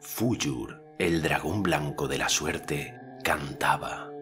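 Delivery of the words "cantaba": plain reading voice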